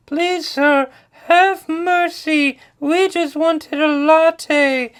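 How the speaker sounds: high, slow, loud pleading little voices; begging for mercy. Acted. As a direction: plead pitifully in a squeaky put-on voice. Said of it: "they beg".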